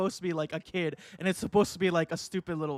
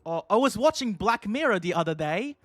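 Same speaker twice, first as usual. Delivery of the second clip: hammed-up British accent; bad British accent; 3 semitones higher than usual. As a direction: try out an exaggerated British voice. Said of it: "I'm British. I gotta channel my inner British".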